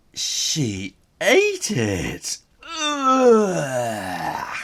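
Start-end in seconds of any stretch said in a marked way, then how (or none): 2.8-4.6 loud groan of disgust; revolted ugh. Pretend. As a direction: groan like something gross happened. Disgust at goo eating.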